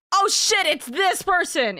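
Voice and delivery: high, loud exclamation of discovery; excited recognition. Acted. Streamer acting out the group recognising a character, excited. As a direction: burst out as if suddenly recognising someone.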